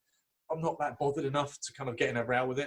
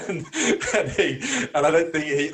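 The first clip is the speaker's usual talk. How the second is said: laughing; 4 semitones higher, 8 dB louder than usual. Real, not acted. Still laughing after the joke.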